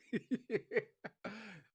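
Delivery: a high burst of giggling; high laugh. Real, not acted.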